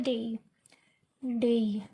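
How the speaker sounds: measured, falling डेई with a clear diphthong; correct pronunciation demo. Shown as a demonstration. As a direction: repeat डेई carefully, letting the ee glide sound. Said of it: "क्या बोलते हैं".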